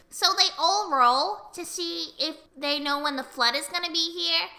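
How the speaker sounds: usual speaking voice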